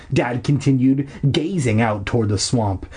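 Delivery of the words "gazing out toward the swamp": plain reading voice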